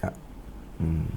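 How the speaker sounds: ordinary delivery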